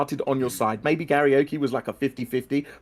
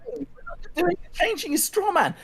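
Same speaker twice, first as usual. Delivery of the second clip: exasperated protest; 8 semitones higher than usual. Real, not acted. Exasperated rebuttal in heated debate.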